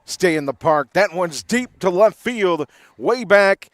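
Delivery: home run call. Real, not acted. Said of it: "rev up the home run call".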